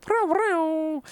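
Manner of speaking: high trilled cat meow; Mikhail. Retold or imitated. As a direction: sing out a meow with rolled R's. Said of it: "rolls his R's".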